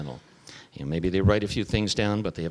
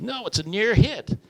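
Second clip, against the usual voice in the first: quoting George Carlin; 14 semitones higher, 4 dB louder than usual. Retold or imitated. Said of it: "He said".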